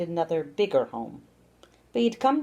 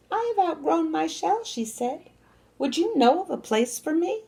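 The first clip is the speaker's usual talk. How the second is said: much higher, small hopeful voice; small hermit crab; 11 semitones higher than usual. A narrator's reading. Little crab asking the bigger crab for a home. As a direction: speak up like a little crab in need.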